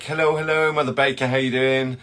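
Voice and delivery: normal talking voice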